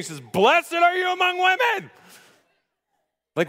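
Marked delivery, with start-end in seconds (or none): 0.2-1.9 a huge, much higher shouted blessing, slowed down; excited scream. Retold or imitated. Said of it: "she is literally screaming".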